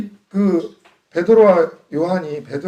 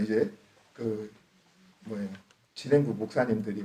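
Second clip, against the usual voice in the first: ordinary talk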